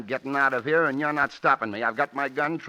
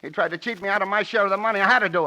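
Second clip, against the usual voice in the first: desperate pleading; 7 semitones higher, 5 dB louder, faster than usual. Acted. Rocky pleads, caught.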